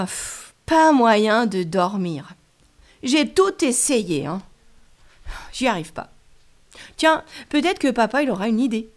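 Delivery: ordinary delivery, nothing marked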